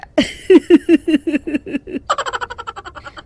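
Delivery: high greedy cackle; gloating villain cackle. Acted. Mechanic gloating to his helper, a high greedy cackle. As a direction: laugh like a crook who fooled someone.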